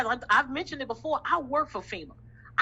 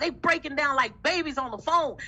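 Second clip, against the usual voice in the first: ordinary delivery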